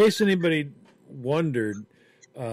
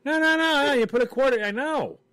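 mock protest; 13 semitones higher, 5 dB louder than usual. Mock petting zoo defender.

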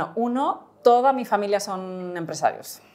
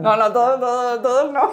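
laughing protest; 6 semitones higher, 7 dB louder than usual. Real, not acted. Guest protests host telling everything.

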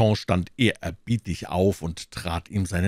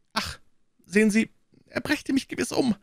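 fearful host; 13 semitones higher than usual. Acted. Innkeeper voiced.

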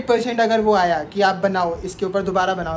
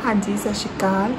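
fake Harleen Kaur. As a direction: play a polite Punjabi woman calling a stranger. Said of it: "ना कि कोई और हरलीन कौर".

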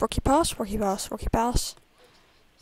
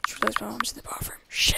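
fast whisper; faster than usual. Real, not acted. Realising his mom is next door, fast real whisper. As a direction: hush it so mom won't hear.

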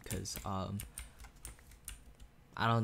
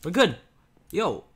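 excited shout; 6 semitones higher, 12 dB louder than usual. Real, not acted. Relief after a close fight.

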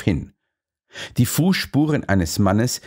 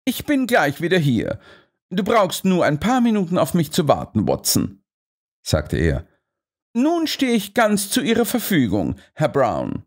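Holmes voice; 8 semitones higher than usual. A narrator's reading.

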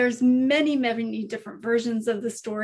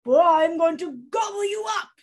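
loud, growling troll refusal; loud, menacing storybook troll; 6 semitones higher, 4 dB louder, faster than usual. Acted. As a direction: boom it out like a mean troll. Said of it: "said the troll".

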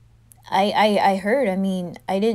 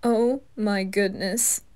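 ordinary delivery

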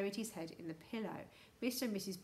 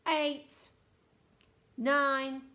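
loud, raised, official count; booming countdown; 5 semitones higher, 9 dB louder than usual. A narrator's reading. Houston voice counts down to the freeze, booming. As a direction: call the number out like a loudspeaker. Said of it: "said the voice".

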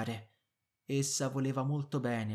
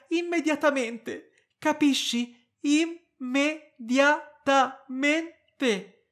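indignant outburst; 13 semitones higher, 11 dB louder than usual. A narrator's reading. Wife furious at her passive husband, shouting indignantly. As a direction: berate him for standing there useless. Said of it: "piena di indignazione".

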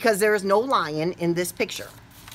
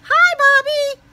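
high, loud bright hello; cheery greeting; 10 dB louder than usual. Shown as a demonstration. Teacher modelling the class greeting Bobby, high and loud. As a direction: greet Bobby like an excited kid. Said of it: "Can you say hi to Bobby?".

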